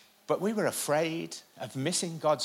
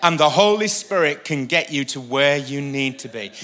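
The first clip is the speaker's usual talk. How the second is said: loud, emphatic sermon proclamation; forceful preacher rallying the congregation; preaching; 7 dB louder than usual. Real, not acted.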